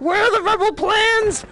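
high, playful yelled demand; theatrical high-pitched yell; mock interrogation shout. Mock interrogation of game figure.

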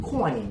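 "Coin" said very distinctly as one syllable; clear pronunciation. Shown as a demonstration. Teacher models the word for a student, clear "Coin". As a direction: say "Coin" crisply, falling at the end. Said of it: "ออกให้มันชัดๆเลย".

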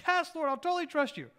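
man dangling from a root, pleading loudly; desperate prayer. Acted. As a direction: beg for rescue while hanging off a cliff. Man begging God in fable.

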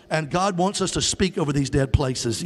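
ordinary talk

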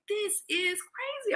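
high, slow, soft amazement; awed disbelief. Retold or imitated. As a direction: stretch it out high and hushed. Stunned by events back to back.

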